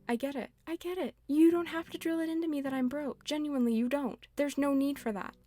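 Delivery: high-pitched, fed-up protest, pitch climbing; exasperated retort. Acted. Ranting at Lloyd for mocking her being broke, exasperated and high. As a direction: push back like you've been nagged one time too many. Snapping back at sarcastic villager.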